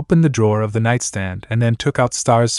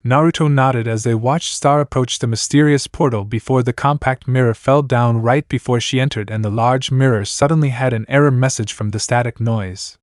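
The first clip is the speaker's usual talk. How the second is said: ordinary delivery, nothing marked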